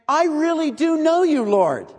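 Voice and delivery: mock earnest insistence; feigned intimacy. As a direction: oversell it like a false friend. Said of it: "is feigning intimacy with the Lord".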